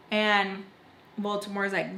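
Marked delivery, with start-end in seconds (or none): none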